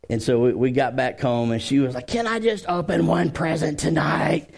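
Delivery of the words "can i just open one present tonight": put-on mildly wheedling kid's plea, putting on a show; a touch of theatrical begging; pleading child, retold. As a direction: imitate a daughter begging, lightly hammed up. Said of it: "she was putting on the show".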